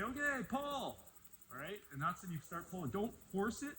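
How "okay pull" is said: calling out. Shown as a demonstration. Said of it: "at some point I'm gonna say".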